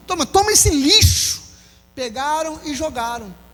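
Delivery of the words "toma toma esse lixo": raised, scornful toss-away voice, pitched higher; mock crowd jeering as they throw it; contemptuous jeer. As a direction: sneer it at a dirty rag. Acting out the crowd throwing Stephen's robe.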